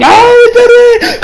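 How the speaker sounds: high, loud and slow, dragged out in triumph; heroine's victory cry; triumphant shout. Retold or imitated. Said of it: "she coming out there chanting".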